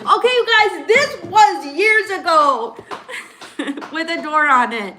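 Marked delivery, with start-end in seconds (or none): 0.0-3.9 high, loud, slowing for emphasis; laughing protest. Real, not acted. Defending oneself to teasing viewers, laughing protest. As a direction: protest loudly on the edge of laughter.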